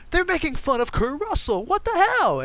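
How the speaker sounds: high-pitched incredulous protest; mock outrage. Outraged audiences react to Kurt Russell as a fool, mock outrage. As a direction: squeal it like a scandalized fan.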